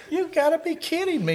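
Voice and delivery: very high incredulous squeal; retold disbelief. Telling how he saw his buddy in the cop's seat, incredulous. Baffled friend watching from afar.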